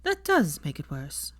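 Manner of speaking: slow, firm and certain; slow, grave conviction. A narrator's reading. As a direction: lay down the judgement. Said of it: "she said with conviction".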